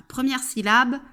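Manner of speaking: usual speaking voice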